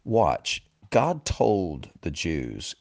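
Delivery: ordinary talk, nothing marked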